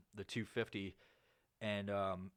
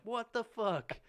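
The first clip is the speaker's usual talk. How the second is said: high, drawn what the fuck; shocked; 12 semitones higher, 3 dB louder, slower than usual. Retold or imitated. Seeing the half-dressed promo girl near kids, high and shocked. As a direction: sound floored by what you see.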